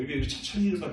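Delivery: ordinary delivery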